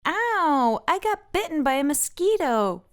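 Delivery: high pained yelp then complaint; Francine yelping. A narrator's reading. Francine bitten by a mosquito, high yelp. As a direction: cry out at the bite, then whine. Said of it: "Francine says".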